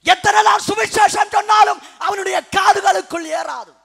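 high, full-force pulpit shout; thundering preaching. Real, not acted. Preacher denouncing those deaf to the gospel, shouting. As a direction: hammer it out at top volume.

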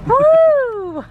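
high loud cheer; excited whoop. Real, not acted. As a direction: whoop with delight. Cheering five-dollar purchase.

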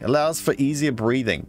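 usual speaking voice

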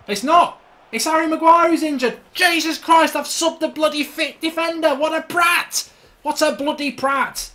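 high, loud self-reproach; appalled outburst. Real, not acted. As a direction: shout in horror at your own blunder. Realises he subbed the wrong defender.

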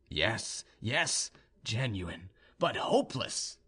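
halting, mostly low and soft; hopeless young suitor. A narrator's reading. Suitor admits his love to her, halting and downcast. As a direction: confess it quietly, in broken phrases.

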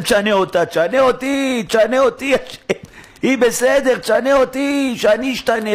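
high, insistent cry to God; urgent pleading prayer. Acted. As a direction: beg loudly, each plea pitched high. Acted urgent prayer to be changed.

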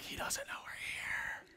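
hushed unvoiced whisper; Adam and Eve hiding from God, whispering. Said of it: "And they're like".

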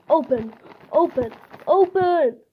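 repeated command rising to a high final cry; growing impatience. Acted. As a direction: build from plain to high, straining insistence. Struggling to open the packet.

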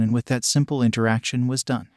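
ordinary delivery

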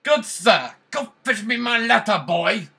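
high, loud haughty command; pompous master. Pretend. Gamer voicing a haughty master, high and loud. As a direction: order the servant boy about grandly.

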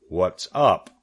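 lightly voiced rat. The rat arrives.

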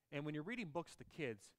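usual speaking voice

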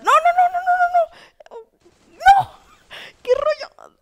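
very high, loud shrieked noes; frantic high-pitched screaming reaction; panicked outcry. Real, not acted. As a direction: wail in disbelief at the top of the voice. Player nearly dies in match.

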